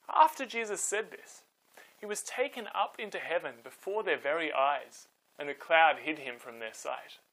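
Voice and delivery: put-on calm storyteller reading to kids; story narration. A narrator's reading. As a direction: tell it gently, like a bedtime Bible story. Reads Bible story to children.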